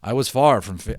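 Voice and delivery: ordinary delivery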